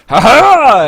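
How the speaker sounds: loud, high barroom guffaw; rowdy drunk laugh. Acted. Drunk patron laughing loudly at the bar. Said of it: "you hear them going".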